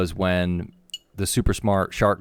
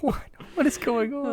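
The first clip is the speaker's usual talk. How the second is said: mock bewildered; 6 dB quieter than usual.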